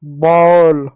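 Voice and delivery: बॉल with a drawn-out "aw"; teacher sounding out बॉल as "bawl"; demonstrated open vowel. Shown as a demonstration. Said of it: "औ की मात्रा पढ़ेंगे".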